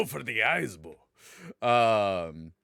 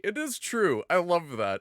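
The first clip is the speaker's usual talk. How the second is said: ordinary talk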